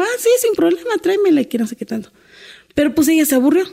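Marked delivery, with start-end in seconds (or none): 0.0-1.4 high, bright quoting of the lady; the employer cheerfully saying yes, much higher; friendly employer. Retold or imitated. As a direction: lift the voice high to play the kind lady. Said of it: "Me dijo".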